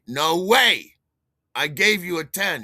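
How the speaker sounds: high, loud indignant objection; loud protest. Acted. Buyer protesting to the dealer, loud indignation. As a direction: protest loudly at being cheated. Acted protest in payment quarrel.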